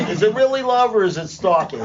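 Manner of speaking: high, laughing through the question; real laughter breaking in. Real, not acted. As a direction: giggle as you ask it.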